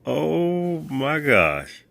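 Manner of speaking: slow high exclamation of shock; stunned oh my gosh. Real, not acted. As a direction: drag it out, floored. Sees the wrong shoes.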